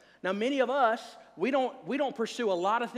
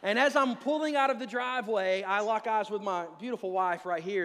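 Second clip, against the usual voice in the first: ordinary talk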